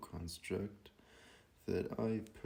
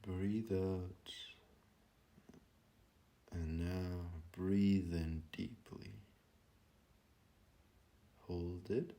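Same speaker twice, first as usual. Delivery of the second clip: slow, hushed meditation voice with long pauses; guided meditation; 12 dB quieter than usual. Real, not acted. Teacher guiding listeners to breathe and be present, calm meditation voice. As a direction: ease them gently into stillness, phrase by unhurried phrase.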